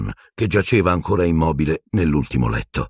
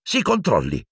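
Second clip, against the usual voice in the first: high, sharp scolding; scandalised order, pitched high; shrill rebuke; 8 semitones higher than usual. A narrator's reading. Matron telling the professor to control himself, shrill. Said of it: "strillò Madame Pomfrey".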